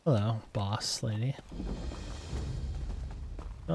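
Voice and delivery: ordinary talk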